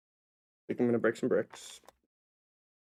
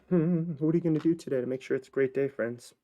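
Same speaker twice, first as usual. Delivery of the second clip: higher, upbeat life coach voice; motivational pep talk; 4 semitones higher than usual. Acted. Hyping up viewers in a cheerful coach voice. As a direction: sell the bright day like a guru.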